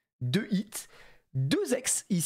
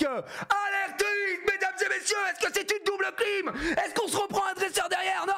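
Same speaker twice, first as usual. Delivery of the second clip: high furious shouting, cursing at the cards; real angry yelling; 13 semitones higher than usual. Real, not acted. Opening a pack live, yelling at the bad pull. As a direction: scream in real frustration, voice cracking high. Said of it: "Elle a eu peur quand t'as crié".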